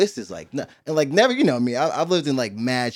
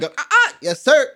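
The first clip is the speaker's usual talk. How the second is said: imitated startled compliance; 14 semitones higher, 6 dB louder than usual. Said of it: "he stops, like".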